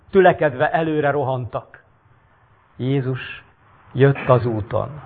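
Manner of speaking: dramatic reading of a Bible-like tale; story narration. A narrator's reading. Reads the story aloud.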